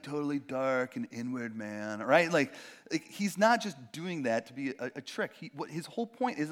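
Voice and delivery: ordinary delivery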